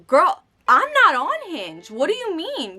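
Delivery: put-on high, loud and slow, girl I'm not on Hinge; indignant retort. Talking back to a friend who mocks her photos, indignant and high.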